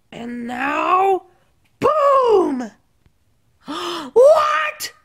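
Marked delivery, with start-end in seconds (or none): 3.7-4.9 gasp, then a high shocked what; stunned by the trick. Acted. Sticks gasps at the magic trick, shocked. Sticks sees ball moved.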